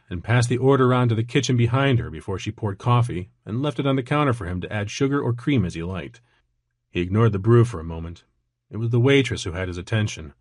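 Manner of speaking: plain reading voice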